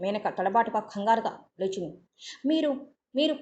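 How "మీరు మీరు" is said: halting, higher, unsure repeat; a hint of a dazed woman faltering as she recognizes him; indistinct stammer. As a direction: stumble over the words, dazed. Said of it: "అస్పష్టంగా అంది".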